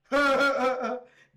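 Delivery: high, loud cackling burst; big loud laugh. Real, not acted. Joker after the snitching punchline, big loud laugh.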